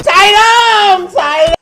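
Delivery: hollering for a friend far off; long, loud calls of a name; calling out loud. Acted.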